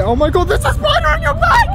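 high panicked scream about a spider; shrieking in fright. Real, not acted. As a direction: squeal it out as if a spider is on them. Scared shriek at friend.

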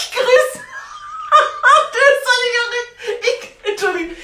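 high squealing laugh through words; laughing too hard to speak. Real, not acted. Lacht beim Begrüßen. (lachend, hoch und quietschend)

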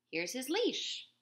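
put-on girl offering her ribbon as leash; girl's eager reply. A narrator's reading. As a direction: hold it up proudly. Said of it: "she said".

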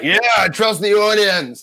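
exaggerated drawn-out cheer; mock self-impression. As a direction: call it out like an over-trusting showman. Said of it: "Here's me just like".

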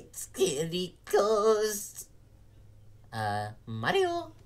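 high, cheerful plumber brother voice; Luigi. Acted. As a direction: call out high and eager. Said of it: "It's me, Super Luigi".

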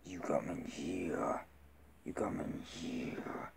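low, soft monster whisper; Venom. Shown as a demonstration. Man echoing the film's threat, whispered monster impression. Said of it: "I got his voice down".

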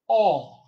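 "aw." as an open-mid back rounded vowel. Shown as a demonstration. Coach demonstrating the third vowel, open-mid rounded "aw.". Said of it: "open mid back rounded".

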